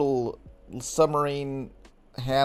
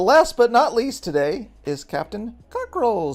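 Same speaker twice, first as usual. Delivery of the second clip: announcer intro; 6 semitones higher, 4 dB louder, slower than usual. Acted. Host announcing final guest.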